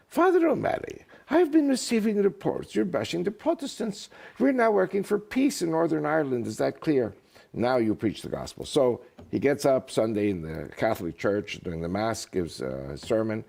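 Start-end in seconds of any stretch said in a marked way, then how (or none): 0.1-8.6 high-pitched Irish archbishop, easing down at the end; Irish accent. Acted. Archbishop telling off a ranting Belfast priest, Irish accent. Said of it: "who says with his Irish accent".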